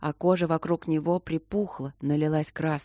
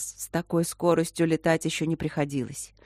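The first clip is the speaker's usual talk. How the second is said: usual speaking voice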